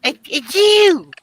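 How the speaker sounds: slow high falsetto taunt; squeaky accusation. Pretend. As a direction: drag it out in a squeaky voice. Same teasing accusation.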